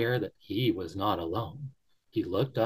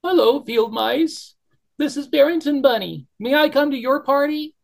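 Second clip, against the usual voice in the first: tearful blurt; 7 dB louder than usual. Acted. Bunny cries out to the field mice, high and loud. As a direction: call out desperately to the mice. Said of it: "blurted out through his tears".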